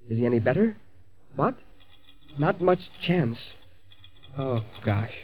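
low, dropping, dismayed voice; worried. Acted. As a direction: take in grim news quietly. Bad news from hospital.

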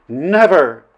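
high, loud, passionate refusal; passionate cry. A narrator's reading. Flynn refuses the princess as he seizes her hand, passionate. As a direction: throw it out forcefully, with passion. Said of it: "he cried passionately".

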